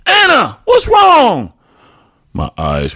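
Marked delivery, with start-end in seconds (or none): 0.1-1.5 very high, loud desperate plea; pleading shout. A narrator's reading. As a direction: beg to know what happened. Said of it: "Daddy begged again".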